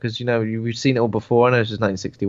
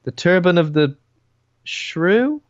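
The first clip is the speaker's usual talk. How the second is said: incredulous; 6 semitones higher, slower than usual. Real, not acted. Mock baffled repeat of title.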